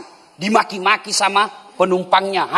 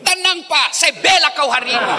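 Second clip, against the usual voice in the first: shouted husband's plea; 8 semitones higher than usual. Retold or imitated. Preacher repeats the husband's plea as a high laughing shout. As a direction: yell it up high like a desperate husband.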